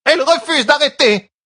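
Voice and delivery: loud, very high furious complaint; shrieking with rage; enraged cry. A narrator's reading. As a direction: cry out in outrage. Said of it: "s'écria Hydrel, rouge de rage".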